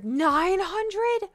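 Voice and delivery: high loud disbelieving question; can't believe the number; shocked question. Real, not acted. Shocked at price.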